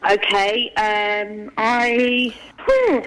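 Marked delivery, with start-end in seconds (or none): 2.6-3.0 puff of tiredness. Real, not acted. Tired sigh.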